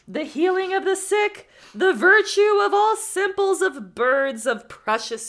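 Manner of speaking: slow, loud, high and grand proclamation; theatrical oracle. Acted. Man in the sack boasting to the student, theatrical and grand. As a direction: declaim it like a wise prophet. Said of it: "this needs to be really theatrical".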